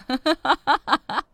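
loud mocking laugh; cruel laugh. Captor laughing over the helpless captive.

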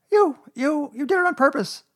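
very high, loud stammered accusation; spluttering Piglet. A narrator's reading. Piglet with a mouthful of soap, spluttering. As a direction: splutter indignantly in a squeaky voice. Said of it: "spluttered Piglet".

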